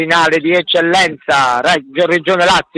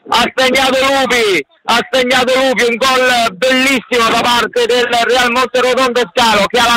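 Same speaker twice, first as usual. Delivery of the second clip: breathless italian goal commentary; 5 semitones higher than usual. Real, not acted. Goal scored, commentator erupts.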